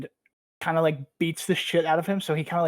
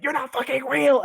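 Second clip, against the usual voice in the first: mock crazed yell; 9 semitones higher, 5 dB louder than usual. Sun losing his mind, retold.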